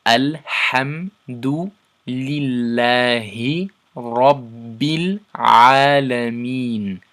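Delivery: slow, chanted Quranic verse; Quran recitation. Shown as a demonstration. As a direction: recite the verse in tajweed style. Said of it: "if we read the whole verse together".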